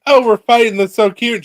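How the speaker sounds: high, loud squeaky cat voice; high excited kitty voice. Retold or imitated. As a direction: voice the cat as a delighted little creature. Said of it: "she's like".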